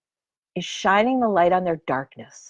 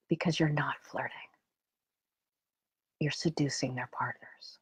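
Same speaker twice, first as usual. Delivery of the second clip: softer and softer, sinking into a whisper; gentle hushed truth; 5 semitones lower, 6 dB quieter than usual. Retold or imitated. Teacher gently telling the student a hard truth, hushed. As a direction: break it to her gently, almost whispering.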